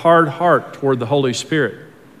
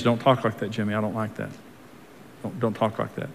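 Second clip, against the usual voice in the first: soft, low, kindly correction; hushed, tender nudging; sweet, gentle conviction; 4 semitones lower, 10 dB quieter than usual. Acted. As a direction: reprove very quietly, like a kind friend. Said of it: "it's very specific and it's very sweet".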